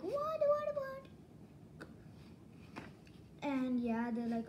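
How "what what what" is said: surprised what. Retold or imitated.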